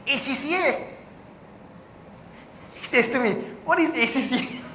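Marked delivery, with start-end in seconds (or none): 0.1-0.9 higher, soft unsure reply; clueless reply. Retold or imitated. Young man unsure before the immigration officer, soft and high. 3.7-4.6 higher, softer baffled question; puzzled officer. Retold or imitated. Immigration officer questioning a student, mock puzzled and high.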